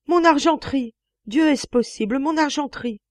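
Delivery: high, loud anguished cry; great cry. Acted. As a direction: wail for the lost silver. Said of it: "un grand cri". (grand cri de désespoir)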